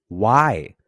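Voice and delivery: loud, punchy demand. A narrator's reading. Pilate challenging the crowd, demanding. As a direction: demand it loudly, as a narrator voicing the governor. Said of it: "Pilate demanded".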